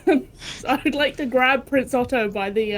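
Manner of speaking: ordinary talk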